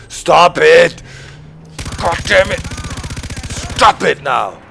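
loud furious Stop it, repeated; fed-up gamer losing his temper; angry yelling. Real, not acted. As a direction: shout at them to quit, loud and angry. Yelling at other players.

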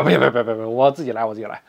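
low, repeated protest fading at the end; flustered refusal. A narrator's reading. Shy Guo Jing turning down the maids' help, flustered refusal. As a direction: wave them off, voice dropping away.

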